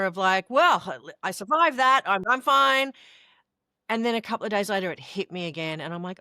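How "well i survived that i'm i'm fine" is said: louder, higher, brightly dismissive; mock-chirpy Pollyanna. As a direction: brush it off like it was nothing, higher and louder. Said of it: "a little bit of Pollyanna".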